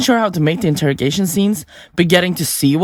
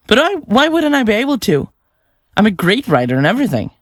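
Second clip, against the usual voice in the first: defensive protest; 7 semitones higher than usual. Acted. Defends self against doubters.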